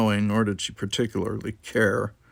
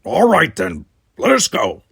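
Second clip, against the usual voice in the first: loud firm command; rally them out; Worf's voice; 6 semitones higher, 8 dB louder than usual. Acted. Worf leading the Klingons out, loud and firm.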